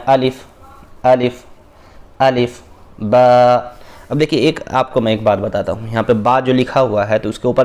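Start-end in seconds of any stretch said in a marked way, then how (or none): 0.1-1.4 الف said short and even, no jerk, not stretched; الف crisp and unstretched, no jolt; short even alif. Shown as a demonstration. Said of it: "اس میں کھینچ کر نہیں پڑھنا ہے". 2.2-3.6 الف short, then با drawn out slowly for one alif length; stretched ba. Shown as a demonstration. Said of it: "ایک الف کے برابر کھینچنا ہے".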